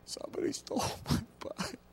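put-on high, breathy little-boy sob through a laugh; mock sobbing child. Boy tells mom his bike was stolen, mock sobbing. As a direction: play the crying kid, half laughing. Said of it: "And I just burst into tears".

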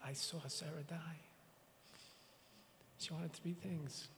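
barely voiced, grief-stricken whisper; a real hushed whisper at the memory; choked whisper. Real, not acted. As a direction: let the words almost vanish with grief. Grieving eulogy for Sarah.

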